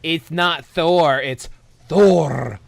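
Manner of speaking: loud, high, hammed-up correction; mock a snob, the last "Thor" boomed with a rolled-out r; slow, overblown know-it-all correcting someone; mocking pedantic corrector. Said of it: "telling other people, like".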